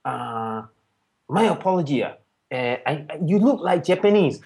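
imitated Japanese accent, halting with hesitations; Japanese-accented English. Japanese friend apologising to a new acquaintance, imitated Japanese accent. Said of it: "in his kind of English".

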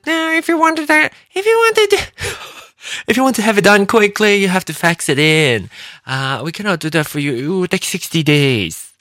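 squeaky imitation of the phone agent; mimicking the Taiwanese agent on the phone; airline agent. Retelling airline agent's reply.

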